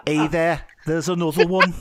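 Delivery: mock Scouse elf shout. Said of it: "my accent's apparently Scouse".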